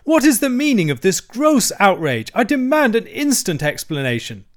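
high, loud, blustering indignation; great spirit. A narrator's reading. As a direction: demand answers in outraged protest. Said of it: "with great spirit".

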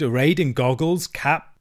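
ordinary delivery, nothing marked

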